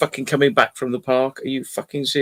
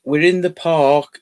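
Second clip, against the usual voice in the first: ordinary talk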